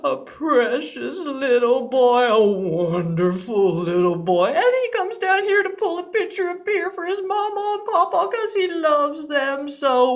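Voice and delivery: hammed-up crying lament; comic wailing. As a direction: blubber through it like a heartbroken fool. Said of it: "wailing and crying louder than ever".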